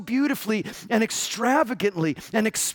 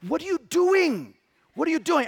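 higher, incredulous repeated question; mock exasperation; 8 semitones higher than usual. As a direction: stare at them, appalled. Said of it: "I wanted to say".

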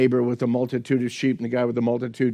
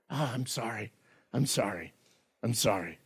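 a murmured, much softer "I'm sorry", just going through the motions; hollow apology; 3 semitones lower, 13 dB quieter than usual. Retold or imitated. Preacher imitating a kid caught red-handed, mumbling a hollow sorry. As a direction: mumble "sorry" only because you got caught.

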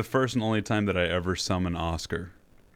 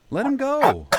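high, loud panicked yell; 6 dB louder than usual. Acted. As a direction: shout at him to let go. Friend in a headlock.

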